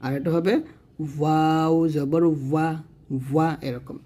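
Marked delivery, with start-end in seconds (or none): 1.2-3.5 slow ওয়াও জবর ওয়া with lips rounded; slow rounded-lip ওয়া demo. Shown as a demonstration. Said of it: "দুই ঠোঁট গোল করে উচ্চারণ করবেন".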